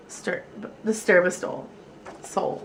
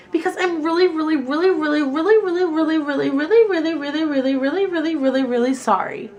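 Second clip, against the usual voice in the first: higher, earnest run of reallys; pleading child; 6 semitones higher, 3 dB louder than usual. A narrator's reading. Lily apologising to Mister Slinger, earnest and pleading. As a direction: pile on the reallys to beg forgiveness.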